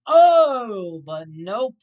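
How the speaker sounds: loud mocking "Oh, but nope"; sarcastic shout. Real, not acted. Ranting at the fight result, loud sarcastic shout. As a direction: yell it out in mock disbelief.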